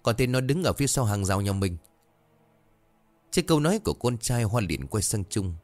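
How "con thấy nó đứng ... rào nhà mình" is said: put-on higher boy's voice, pressing hard; a boy refusing to be doubted; child insisting. Cò insists to his mother he saw Tí, higher urgent boy voice.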